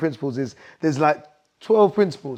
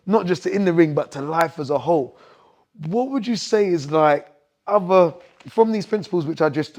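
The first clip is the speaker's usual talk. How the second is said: ordinary delivery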